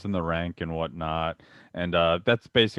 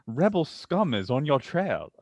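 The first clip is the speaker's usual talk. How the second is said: higher-pitched British Imperial officer; British accent; 6 semitones higher than usual. Acted. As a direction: sneak in a put-on British warning. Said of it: "putting on the British accent".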